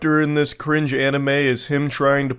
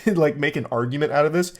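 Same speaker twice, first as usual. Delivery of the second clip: usual speaking voice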